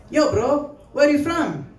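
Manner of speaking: badly faked Indian accent on a familiar question; attempted Indian accent. Classmate asking the comic his origins, badly faked Indian accent. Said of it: "I can't do a good Indian accent".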